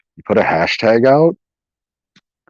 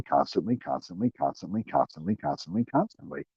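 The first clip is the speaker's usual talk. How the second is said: each "constantly" repeated, dropping to near silence; fading repetition; 5 semitones lower, 15 dB quieter, faster than usual. Shown as a demonstration. Mocking streamers who swear nonstop, fading repetition. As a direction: let the word die away as you repeat it. Repeating a word to mock overuse.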